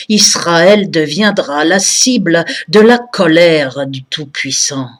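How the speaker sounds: declaimed poem with emphatic, swelling stresses; récitation de poème. Acted. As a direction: recite verse solemnly, line by measured line. (déclamation poétique engagée et emphatique)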